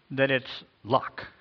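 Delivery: ordinary delivery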